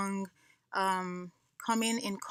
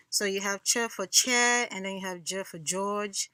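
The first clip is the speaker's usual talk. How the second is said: ordinary talk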